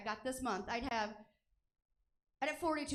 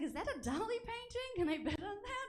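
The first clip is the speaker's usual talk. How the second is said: high-pitched, mock-curious art buyer; put-on onlooker voice; 9 semitones higher than usual. Onlooker asking to bid on a painting, high put-on voice. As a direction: ask it high, imitating an eager bidder.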